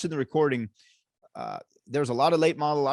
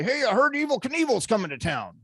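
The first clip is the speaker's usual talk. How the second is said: higher put-on caller voice, disguised; fake voice; 8 semitones higher than usual. Stuntman calling a hotel in a disguised voice. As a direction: disguise your voice like a prank caller. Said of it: "use a fake voice and say".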